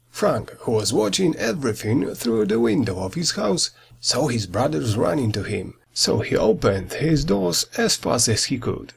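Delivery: ordinary delivery, nothing marked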